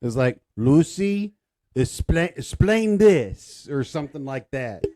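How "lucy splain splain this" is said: loud, higher exasperated call; quoting a TV husband. Retold or imitated. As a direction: call out and draw the words out. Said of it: "he never said that".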